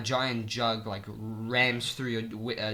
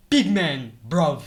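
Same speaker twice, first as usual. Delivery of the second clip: loud mock British lad, pitched up; British accent; 3 semitones higher, 6 dB louder, slower than usual. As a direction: call out in a hammed-up British accent. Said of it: "now I just do it like how they actually say it".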